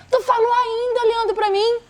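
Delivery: high, affronted re-enacted retort; retold indignation. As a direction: throw it back at the husband, scandalised. (indignação encenada, bem aguda)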